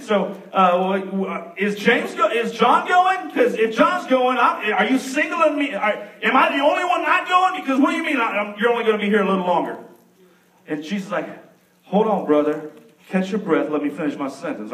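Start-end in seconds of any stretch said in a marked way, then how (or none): 0.7-9.8 high, rushed, worried questions; frantic Peter. Acted. Peter fretting to Jesus about being left, high and anxious. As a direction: sputter out the questions in a panic.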